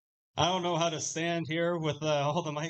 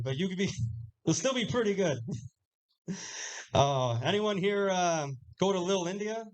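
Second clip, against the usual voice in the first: normal talking voice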